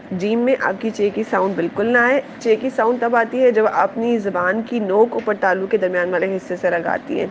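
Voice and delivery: normal talking voice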